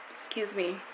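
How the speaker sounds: ordinary delivery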